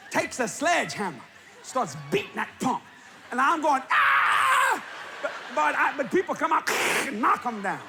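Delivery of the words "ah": high loud yelp of alarm; scream. Retold or imitated. Held aloft by the hose, yelping in alarm. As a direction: let out a sharp scream.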